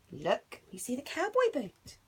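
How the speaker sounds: usual speaking voice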